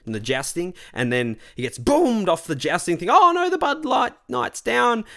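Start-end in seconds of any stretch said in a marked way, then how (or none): 3.1-5.0 high, drawn-out mock cry of alarm; mock dismay. Crowd reacting to the fallen knight in a commercial, mock dismay. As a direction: cry out in exaggerated dismay, stretching it slowly.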